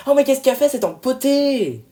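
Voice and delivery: loud, high, exasperated cry; wife losing it with her husband; exasperated outburst. Acted. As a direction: blow up at the clumsy husband.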